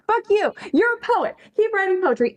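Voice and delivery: fierce, shouting pep talk; aggressive support. Real, not acted. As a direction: yell encouragement at the doubter. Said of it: "aggressively supportive".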